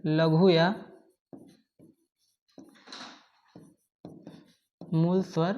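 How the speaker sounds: word-by-word dictation with long pauses. Real, not acted. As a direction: dictate slowly for note-takers, a few words at a time.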